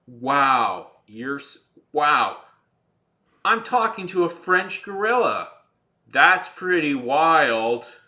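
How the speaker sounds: mock amazement. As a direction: ham up amazement at the absurd. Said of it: "and they'll just go like".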